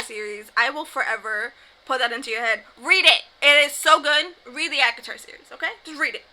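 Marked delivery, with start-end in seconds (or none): 2.8-6.2 emphatic plea. Acted. Urging viewers to read.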